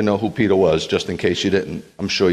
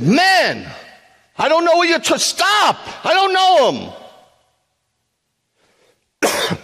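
shouting, very high, panicking; desperate shouted denial; 4 dB louder, slower than usual. Acted. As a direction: scream the denial to make them stop. Peter's panicked third denial.

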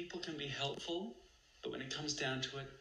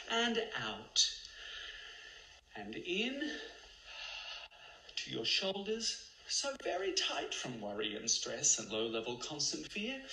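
guided meditation; 2 semitones higher than usual. Acted. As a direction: lead them gently through the breath.

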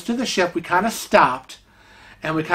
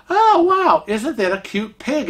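gushing; 7 semitones higher than usual. Retold or imitated. Gushing over the wooden pig.

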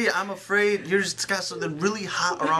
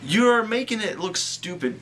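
ordinary talk, nothing marked

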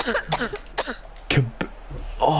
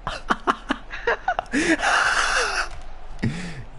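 long, high, soft wheezing laugh; real high wheezy laughter; 6 dB quieter than usual. Real, not acted. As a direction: let a long helpless laugh run out. Laughing at roast.

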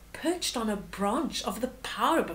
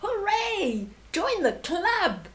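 high, slow cheer of welcome; joyful shout; 8 semitones higher, slower than usual. Acted. Wildebeest welcomes the hyena with a cheer. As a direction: call out happily, hooray. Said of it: "the wildebeest shouted".

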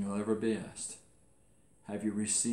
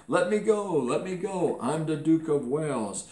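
high, loud mock yell, fading at the end; blurted plea; 6 semitones higher, 4 dB louder than usual. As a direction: blurt it out like a caught boy. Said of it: "his cousin blurted out".

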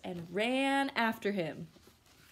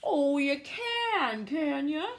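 slow, louder teasing growl; sly bear; 5 semitones higher, 6 dB louder, much slower than usual. Acted. Bear mocking the boastful gingerbread man, slow. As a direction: drag it out, doubting him.

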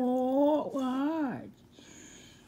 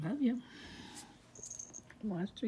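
ordinary talk

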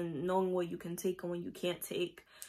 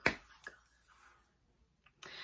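breathless whispered "Oh my God", much softer and slow; exasperated whisper; 16 dB quieter, much slower than usual. Retold or imitated. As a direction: sigh it out under your breath, worn out by the customer. Exasperated at rude customer.

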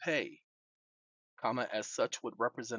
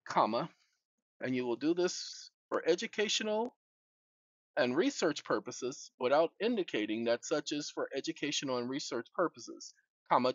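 dictating; 2 semitones higher than usual. Real, not acted. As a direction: speak each phrase for voice typing. Dictating to a phone.

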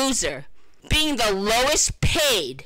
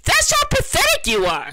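pitched way up in scorn; scornful high jab; 12 semitones higher than usual. Real, not acted. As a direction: spike the pitch high, full of contempt. Contemptuous climax of insults.

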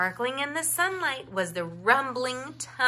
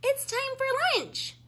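much higher calling voice; calling sister bear. A narrator's reading. Sister bear calling her brother in for lunch. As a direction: call him in for lunch. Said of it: "called his sister Molly".

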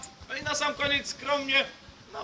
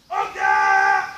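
high loud order to fire; shouted command; 8 semitones higher, 9 dB louder than usual. Acted.

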